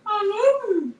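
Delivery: very high, crooning doggy voice; talking dog. Retold or imitated. Owner showing how her dog talks, high doggy croon. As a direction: howl the words like a dog, way up high. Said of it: "He says".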